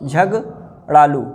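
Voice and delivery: slow "झगड़ालू" split as झग, pause, ड़ालू; broken into parts. Shown as a demonstration. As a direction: dictate "झगड़ालू" very slowly with a gap in the middle. Teacher breaks word up slowly.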